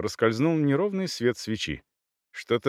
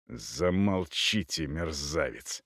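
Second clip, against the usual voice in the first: slow, low, quiet menace; cold anger; 5 semitones lower, 7 dB quieter, slower than usual. Acted. Cavalier stung by the captive's words, quietly angry. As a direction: order him silent, holding fury down.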